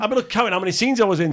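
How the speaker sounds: ordinary talk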